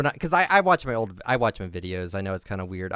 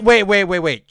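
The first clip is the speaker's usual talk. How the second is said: usual speaking voice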